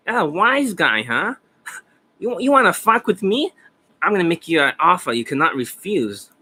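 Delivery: put-on high, gangster movie mobster accent with a tough Italian-American twang. To the coaching client about looking Italian, a mock mobster Italian accent. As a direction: play a wiseguy threatening someone, mock Italian mobster accent.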